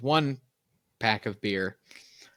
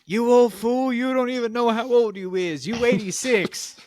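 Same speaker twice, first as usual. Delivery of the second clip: put-on much higher, louder old woman scolding from upstairs; old wife yelling; 12 semitones higher, 4 dB louder than usual. Wife shouting at her husband from the second floor, high scolding yell. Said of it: "his wife yells from the second floor".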